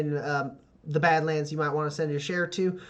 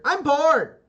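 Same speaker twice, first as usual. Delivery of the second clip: put-on high whiny kid voice; bored kid; 12 semitones higher, 6 dB louder than usual. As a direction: whine like a bored child. Kid voice from behind.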